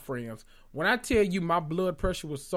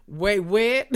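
higher, louder mock-incredulous outburst; indignant disbelief; 5 semitones higher, 5 dB louder than usual. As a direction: act out a baffled, put-out reaction. Acts out indignant cast member.

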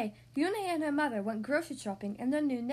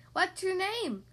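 shouted question; 7 semitones higher, slower than usual. Acted. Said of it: "someone shouted".

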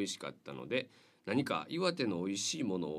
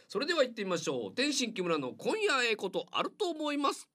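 much higher, hyped radio title call; 4 dB louder than usual. Acted. Radio host kicking off the corner with a high, hyped title call. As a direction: announce the show name like a jingle.

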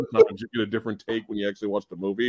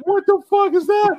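much higher, incredulous cry; mock disbelief; 3 dB louder than usual. Reacting to the bad commercial, high incredulous cry. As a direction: react as if seeing something absurd. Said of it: "I was like".

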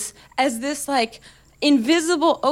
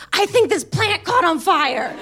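higher-pitched mock tipsy outburst; tipsy blurting; 5 semitones higher than usual. Tipsy guest answering a whispering cater waiter, blurted high. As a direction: blurt it out like someone tipsy and caught out. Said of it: "being tipsy".

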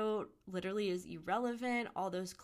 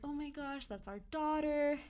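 stunned and high; 7 semitones higher than usual. Retold or imitated. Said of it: "even saying "That's our daughter" felt so weird".

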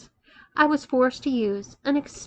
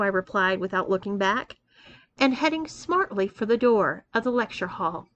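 plain reading voice

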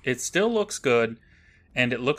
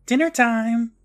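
high, loud call-out; mock yell; 11 semitones higher, 5 dB louder, slower than usual. Mom randomly yells to defuse the argument, mock yell. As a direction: call the family in, sing-out style. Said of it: "Mom diffuses the situation by just randomly yelling".

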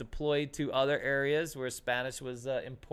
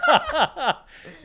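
big burst of laughter, higher and louder; 12 semitones higher, 11 dB louder than usual. Real, not acted. Teller laughing at his own punchline, loud high laugh. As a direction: crack up loudly.

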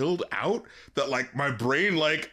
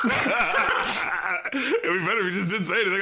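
slow laughing remark; 3 semitones higher than usual. Real, not acted. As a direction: say it slowly through laughter. Laughing about awkward apologies.

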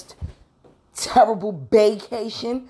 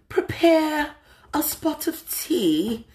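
put-on posh British accent, slow and drawn out on the tea; mock British accent; 10 semitones higher, slower than usual. Podcaster hamming up a British accent for high tea. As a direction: play at a proper English lady with her tea. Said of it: "why am I speaking in that U K voice".

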